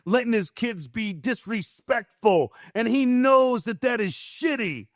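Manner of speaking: worked-up, emphatic high rant; righteous indignation. Retold or imitated. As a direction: build a tirade of imitated condemnation. Builds man's righteous judgement, heated.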